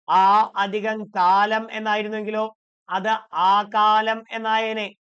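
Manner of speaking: loud and very slow, each part held apart; slow spelled-out demo. Shown as a demonstration. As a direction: drag out every piece so the class hears it. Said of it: "teacher demonstrating the sandhi".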